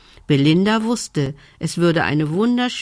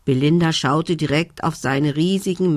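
usual speaking voice

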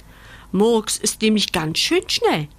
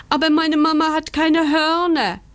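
high, loud whining protest; 9 semitones higher, 4 dB louder than usual. Acted. Puppy protests to the rabbit, whining. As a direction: whine it like a let-down pup. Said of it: "jault Bandit".

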